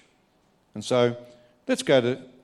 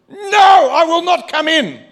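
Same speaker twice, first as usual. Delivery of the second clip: much higher, much louder furious no; angry refusal; 11 dB louder than usual. Acted. Older brother refusing to come into the feast, angry shout. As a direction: refuse the father at full voice.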